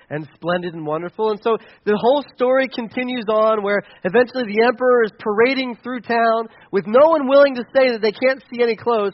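ordinary delivery

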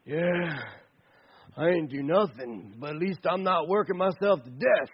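lightly voiced lazy slacker; lazy guy's talk. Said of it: "that's the way you have to talk like that".

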